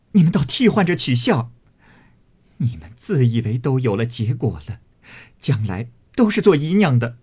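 heated, agitated telling-off, loud and high; cursing in hurt, anxious anger; angry scolding. A narrator's reading. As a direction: lash out at teasing friends. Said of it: "又是气又是躁又是急， 因骂道".

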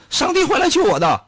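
high, stubborn believer's voice; hammed-up devout man; mock pious faith. Man in flood waiting for God.

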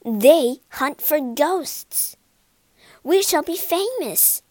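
loud, rising excitement; acted thrill at coming fame; excited. A narrator's reading. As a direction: build to a high, thrilled finish. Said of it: "said Miss Lacy excitedly".

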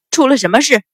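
loud, stern demand; commanding, angry interruption; angry shout. A narrator's reading. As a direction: roar the question on arriving. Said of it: "随着一声怒喝".

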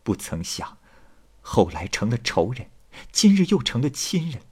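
soft, tender, measured speech; heartfelt gratitude. Acted. Wife holding Tang's hand to thank her, soft and heartfelt. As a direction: speak gently, as if confiding in a new sister.

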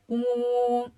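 droning buzz on one steady note; imitated drill hum. Storyteller imitates the drill starting up, droning hum. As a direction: hum like a running electric drill.

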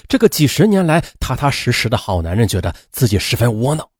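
plain reading voice

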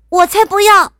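high, loud defiant princess; shouting. A narrator's reading. Princess refusing the king's last chance, shouting. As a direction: yell a flat refusal in a high child's voice. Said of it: "伊莎喊道".

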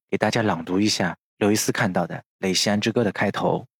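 ordinary talk, nothing marked

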